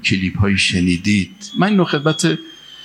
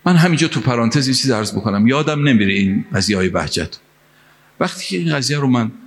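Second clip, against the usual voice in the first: ordinary talk, nothing marked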